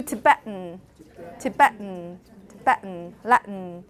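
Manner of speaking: Tibetan and Latin with the T caught in the throat; Tibetan, Latin: glottal catch straight into N; glottal stop. Shown as a demonstration. Teacher models own pronunciation, Tibetan with glottal stop. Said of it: "noticed that from my pronunciation".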